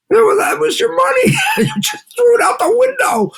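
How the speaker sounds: hammed-up scold about wasted money; shouting the point home, half in jest; mock shout. Mock scolding of the bar-goer.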